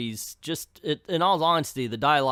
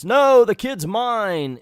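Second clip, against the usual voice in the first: loud, slow, higher retold claim; mock claim; 8 semitones higher, 9 dB louder, slower than usual. Lupex claiming the kid, loud slow mock shout.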